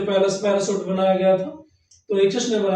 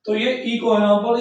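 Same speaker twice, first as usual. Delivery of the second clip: ordinary talk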